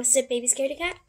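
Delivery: ordinary delivery, nothing marked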